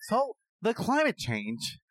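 high-pitched, swooping caricature of a pundit; hammed-up impression, voice climbing high; Jordan Peterson. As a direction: exaggerate the rising, wavering pitch of a pompous professor. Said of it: "And he talks like this".

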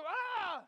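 loud, high exasperated yell; mock frustrated shout; frustrated cry. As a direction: let frustration burst out. Frustrated outburst.

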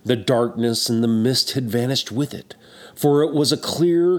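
plain reading voice

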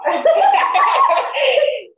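sudden high, loud laugh that breaks out; a real, loud, high laugh; loud burst of laughter. Real, not acted. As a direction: burst out laughing loudly at your own memory. Loud laughing burst mid-story.

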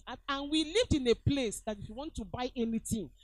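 ordinary delivery

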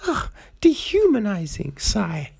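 high, prim put-upon voice; hammed-up prim objection, voice up high; mock prissy refusal. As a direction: play her as a fussy, offended lady. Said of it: "She's just like".

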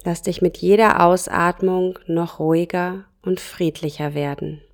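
ordinary talk, nothing marked

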